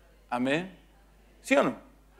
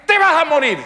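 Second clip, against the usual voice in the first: high, loud punchline shout; shouted comic verdict; 12 semitones higher, 9 dB louder than usual. Acted. Wife telling husband his fate, shouted bluntly. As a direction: blurt out the doom as a comic shout.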